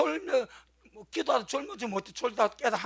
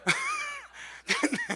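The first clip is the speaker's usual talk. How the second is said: laugh. Real, not acted. Laughs at own cat story.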